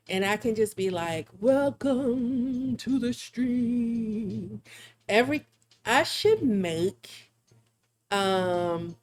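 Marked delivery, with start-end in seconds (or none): 1.4-4.6 very slow eerie welcome; spooky voice. Pretend. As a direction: stretch every word like a ghost host. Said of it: "it sounds spooky or whatever".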